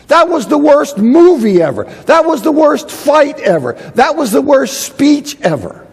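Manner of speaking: exaggerated complaining. Shown as a demonstration. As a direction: ham up each worst ever line. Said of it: "When we say that".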